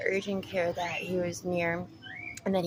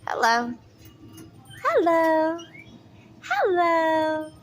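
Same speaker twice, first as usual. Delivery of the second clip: high, loud sing-song cooing at a bird; pet talk; 9 semitones higher, 6 dB louder, slower than usual. Real, not acted. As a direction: coo to the parrots like babies.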